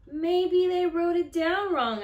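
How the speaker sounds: cling to a last hope; hopeful boy. Acted. Boy at the shoe store hoping the price is wrong, hopeful boy voice.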